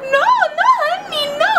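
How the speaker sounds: very high, drawn-out protest; squealed theatrical despair; mock wail. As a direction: wail it as if condemned. Acted protest at prison sentence.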